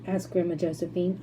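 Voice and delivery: plain reading voice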